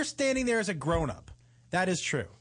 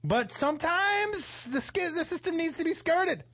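mock righteous; 10 semitones higher than usual. Real, not acted. Playful justification.

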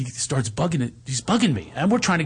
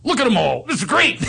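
high, hurried-glance bad acting; unnatural line reading; 9 semitones higher, 3 dB louder than usual. Coached amateur actor after one glance, stilted high recital. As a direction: blurt the scripted line, pitched up. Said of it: "takes one glance".

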